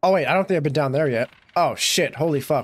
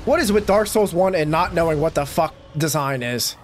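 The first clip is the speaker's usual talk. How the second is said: usual speaking voice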